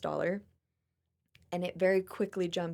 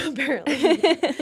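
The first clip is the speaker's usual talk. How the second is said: laughing it out, higher and louder, slow; 8 semitones higher, 10 dB louder, slower than usual. Real, not acted. Joking about her chickens, laughing. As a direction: dissolve into laughter. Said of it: "laugh heard".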